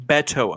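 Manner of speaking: Beto said BET-oh; short E as in "bet" at the start of Beto; corrected pronunciation. Shown as a demonstration. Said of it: "Beto, not Beto".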